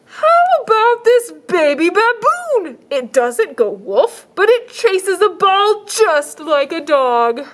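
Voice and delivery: high, slow salesman pitch; shelter man voice. Acted. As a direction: sell it like an eager shopkeeper. Shelter man voiced offering pet.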